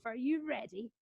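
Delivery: ordinary talk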